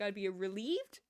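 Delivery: usual speaking voice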